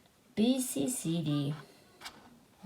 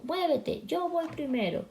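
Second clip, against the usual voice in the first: mock shout; 6 semitones higher than usual. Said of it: "dijo gritando".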